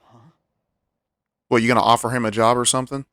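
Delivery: ordinary talk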